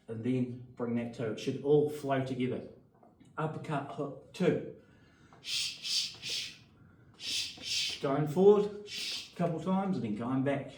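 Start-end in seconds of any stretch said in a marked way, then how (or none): 5.4-8.0 sharp punch exhales. Shown as a demonstration.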